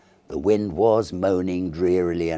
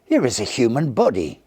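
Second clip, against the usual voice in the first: high, loud shocked outburst; a loud, appalled cry of discovery; horrified exclaim; 7 semitones higher, 6 dB louder than usual. A narrator's reading. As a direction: cry it out recoiling from the sack. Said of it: "he exclaimed".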